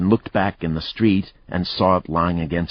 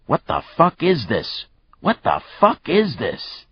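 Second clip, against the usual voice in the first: yelled; 9 semitones higher than usual. Acted. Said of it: "One of the cops yelled".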